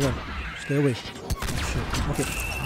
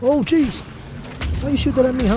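high, loud complaint; indignant gamer outburst; startled protest; 10 semitones higher, 6 dB louder than usual. Real, not acted. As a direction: yell at the shooter in dismay. Shot at by enemy.

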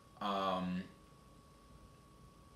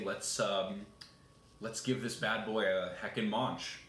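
normal talking voice

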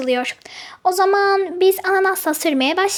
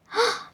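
shocked gasp; 3 semitones higher, 10 dB quieter than usual. Acted. Hears bike was taken.

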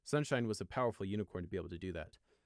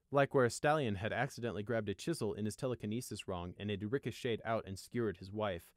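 ordinary delivery, nothing marked

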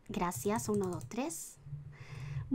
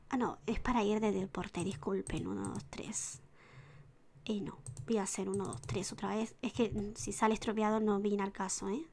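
ordinary talk, nothing marked

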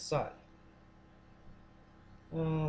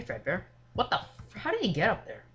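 normal talking voice

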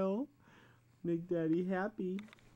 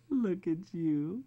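ordinary talk

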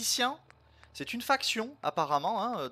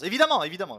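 loud insistent of course; emphatic agreement; 4 semitones higher, 10 dB louder, much faster than usual. Real, not acted. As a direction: hammer the agreement home. Approbation emphatique répétée. (évidemment appuyé et fort)

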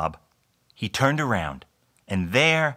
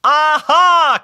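very high, loud "aha"; big storybook eureka; triumphant cry; 11 dB louder than usual. A narrator's reading. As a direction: cry out with sudden delight. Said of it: "cried Bob".

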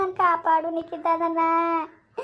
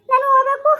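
higher, louder crying wail; terrified patient wails to the in-laws, higher and louder crying; wailing in pain; 6 semitones higher, 4 dB louder than usual. Acted. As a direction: howl with the pain as if begging to be let go.

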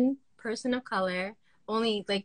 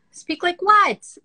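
indignant question; 6 semitones higher, 6 dB louder, much slower than usual. Real, not acted.

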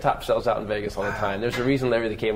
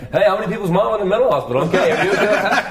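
higher, louder, quick observational-comic bounce; Seinfeld impression; 8 semitones higher, 6 dB louder than usual. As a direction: toss it off like a sitcom stand-up, laughing. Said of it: "but I was doing it like Seinfeld".

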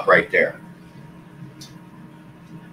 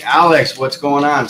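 calling out; 7 semitones higher, 7 dB louder than usual. Real, not acted. Calling out to Alex.

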